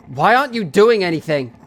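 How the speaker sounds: frightened, reproachful outburst; scared plea. Acted. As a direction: plead in alarm, voice lifted.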